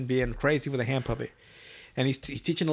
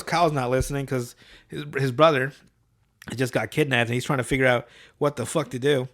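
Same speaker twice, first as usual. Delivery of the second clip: usual speaking voice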